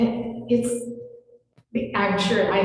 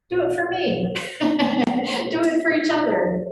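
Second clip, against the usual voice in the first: playful plea; 7 semitones higher than usual. Real, not acted.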